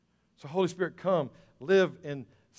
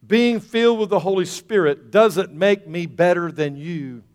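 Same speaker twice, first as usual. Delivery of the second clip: loud, raised, emphatic proclamation; full-force preaching; 5 semitones higher, 10 dB louder than usual. Real, not acted. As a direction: deliver the key point forcefully to the congregation.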